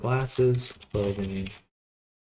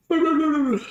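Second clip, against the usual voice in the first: laughing; 7 dB louder than usual. Real, not acted. Laughs after yawning mid-sentence.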